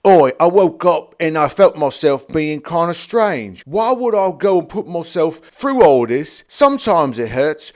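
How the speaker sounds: hammed-up Cockney accent; silly Cockney. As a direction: play the scene as an over-the-top Cockney. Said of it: "play the scene like this".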